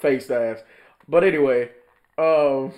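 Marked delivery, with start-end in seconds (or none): none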